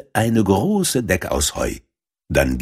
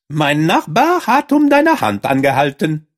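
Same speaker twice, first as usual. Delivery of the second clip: higher, lightly voiced field mouse; a hint of the busy matchmaking mouse; 8 semitones higher, 4 dB louder than usual.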